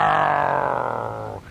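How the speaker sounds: mock lion roar. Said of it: "he gave out a great roar".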